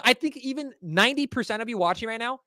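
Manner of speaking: ordinary delivery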